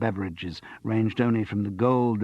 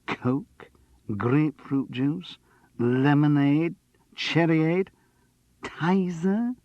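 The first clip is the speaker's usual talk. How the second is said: each drink name higher, more disgusted; mounting distaste; 8 semitones higher, 4 dB louder, much slower than usual. Acted. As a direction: sneer harder with every name. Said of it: "pronounced each name with mounting distaste".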